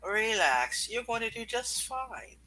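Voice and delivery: ordinary delivery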